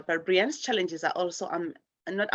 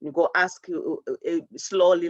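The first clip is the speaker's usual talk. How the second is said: normal talking voice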